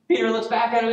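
high, loud storytelling from the pulpit; animated preaching. Real, not acted. Preacher introducing Peter's reply to Jesus, loud and animated.

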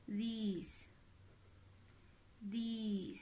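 This repeated with the i stretched to a long ee each time; teacher models these with an elongated ee, over and over; lengthened vowel drill. Shown as a demonstration. Teacher drilling the class on the long ee in This. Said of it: "que significa que lo voy a alargar".

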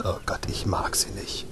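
hushed, voiceless grumble; whispered aside. Real, not acted. Gamer whispering his dislike of the companion. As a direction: whisper it so she won't hear.

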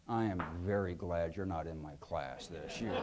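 put-on low, quiet, deadpan line from an old teacher; dry teacher's jab. Teacher peering at a student, low and quiet.

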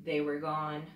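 put-on lively read-aloud voice for children; storybook reading. A narrator's reading. Mother reading a picture book to children, lively storybook voice.

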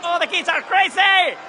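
ordinary talk, nothing marked

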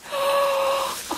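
breathless squeaky gasp of delight; high gasp. Real, not acted. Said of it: "so, so excited".

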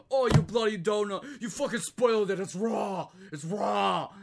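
loud, high mock chef shouting; furious Gordon Ramsay. Chef yelling over spoiled food, hammed-up fury. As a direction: blow up at the smallest mistake. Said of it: "he's like".

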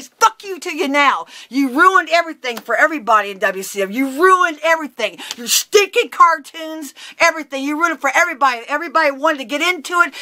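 high, loud tirade; angry rant. Retold or imitated. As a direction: pile on accusations in a heated yell. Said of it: "I just blew up".

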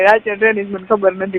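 ordinary talk, nothing marked

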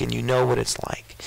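hushed, breathy whisper; whispered aside to the congregation. Real, not acted. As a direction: drop to a whisper to draw them in.

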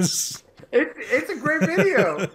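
high, slow laughing words; laughing sarcasm. Real, not acted. Friend laughing about the video, high and slow. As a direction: laugh it out while praising.

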